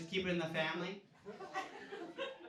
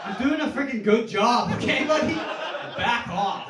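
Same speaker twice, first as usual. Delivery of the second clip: higher, much louder, laughing indignant yell; indignant shout; 6 semitones higher, 12 dB louder than usual. Acted. Comic telling off the teen lifeguard, laughing indignant shout. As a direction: bark it back at the know-it-all lifeguard.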